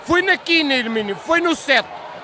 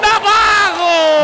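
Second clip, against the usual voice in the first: long stretched goal yell, much louder; explosive goal commentary; 5 semitones higher, 7 dB louder, much slower than usual. Real, not acted. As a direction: hold the name out in a roaring goal call. Goal scored, long shouted call.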